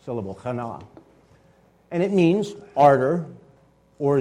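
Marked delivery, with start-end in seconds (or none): 0.1-0.8 chana with the stress on the second syllable, cha-NA; Hebrew word demo. Shown as a demonstration. Teacher showing the class how to stress chana. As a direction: say chana leaning on the last syllable. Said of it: "the emphasis is on the second syllable".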